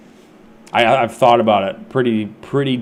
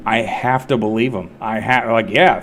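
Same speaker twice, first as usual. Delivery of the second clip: ordinary talk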